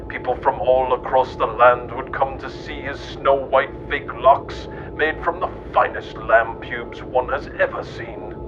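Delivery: usual speaking voice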